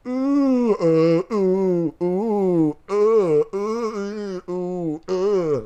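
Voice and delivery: warbling ooh and uh way up high; a hammed-up high warble in a singer's style; high singing warble. Vlogger mocks a singer on screen, high warbling ooh and uh.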